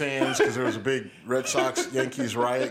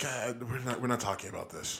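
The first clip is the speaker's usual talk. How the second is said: quiet embarrassed dismissal; 2 semitones lower, 9 dB quieter than usual. Retold or imitated. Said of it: "I'm just like".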